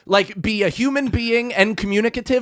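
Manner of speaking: very high comic squawk settling into a pleading rant; exasperated caller. Retold or imitated. Customer to a silent clerk about urgency, exasperated. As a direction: start in high-pitched mock disbelief, then ease down.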